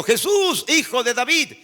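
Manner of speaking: high pleading call to Jesus, Jesús leaping up; a blind beggar calling out to Jesus, high and pleading; crying out. Acted. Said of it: "le dijo".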